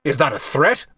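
indignant challenge, rising sharply at the end; barked. A narrator's reading. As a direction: bark it as a challenge. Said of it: "he barked".

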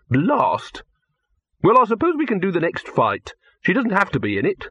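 high-pitched, grudging grumble; grumpy. A narrator's reading. Director settles for shooting the next fight, grumpy. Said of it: "said Silverfish grumpily".

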